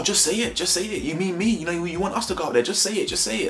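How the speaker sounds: mock Anton, high and goading. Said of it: "He even says".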